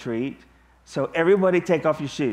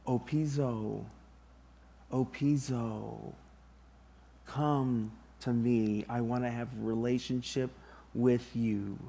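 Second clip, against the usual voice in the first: tender invitation; 3 semitones lower, 8 dB quieter than usual. Acted. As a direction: invite him in close, gently. Preacher voices Jesus inviting man.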